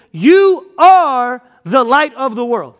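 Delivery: slow, weighty, each word laid down; quoting Jesus with slow weight; solemn slow quote. Shown as a demonstration. Preacher quoting Jesus slowly and deliberately.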